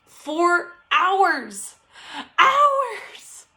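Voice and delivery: outraged disbelief climbing on hours; exasperated. Real, not acted. Telling moms how long the baby cried, exasperated high cry. Exasperated about baby's sleep.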